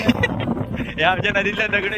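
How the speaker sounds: loud, hammed-up tour guide; mock vlogger tour guide. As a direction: show off the village like a vlogger.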